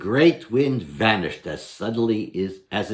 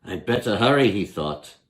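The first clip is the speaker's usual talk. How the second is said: louder, hurried cheer; eager Herschel; 7 semitones higher, 5 dB louder, faster than usual. Acted. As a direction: rush off happily to the village.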